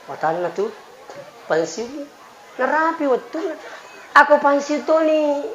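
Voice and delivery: usual speaking voice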